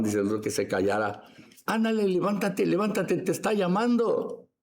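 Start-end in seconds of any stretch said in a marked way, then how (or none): 1.7-4.5 high-pitched, eager prodding; imitated crowd egging him on; acted urging. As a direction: urge someone to get up and go. Acts crowd urging blind man up.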